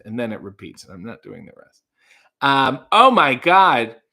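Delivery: ordinary delivery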